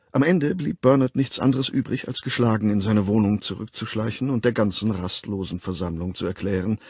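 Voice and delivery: ordinary delivery, nothing marked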